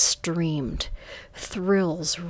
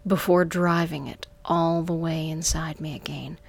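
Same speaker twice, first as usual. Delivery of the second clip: plain reading voice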